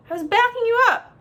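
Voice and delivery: high, loud, rushed sibling whine; indignant protest. A narrator's reading. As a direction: protest defensively as the scolded brother. Brother protests sister's scolding.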